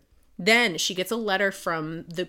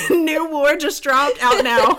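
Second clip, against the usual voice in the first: louder and higher, laughing mock announcement; laughing hype; 8 semitones higher, 10 dB louder than usual. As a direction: announce it like a release trailer while laughing. Mocking hype announcement joke.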